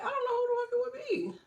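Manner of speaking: baffled whine. Retold or imitated. Vlogger whining that no relative admits to calling. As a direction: squeak it out, truly stumped.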